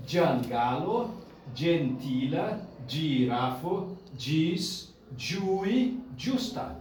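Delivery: slow "giangalo, gentila, girafo" with dj onsets; dj sound demonstrated. Shown as a demonstration. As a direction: model "giui, giusta" word by word with dj. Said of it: "la litera jo".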